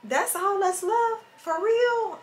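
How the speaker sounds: high disbelieving cries; shocked. Retold or imitated. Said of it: "I be like".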